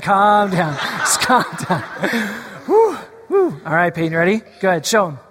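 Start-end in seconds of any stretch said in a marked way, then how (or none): 0.0-3.6 talking through real laughter; giggling while telling them to calm down; laughing. Real, not acted. Pastor laughing at a child's angry face, through laughter.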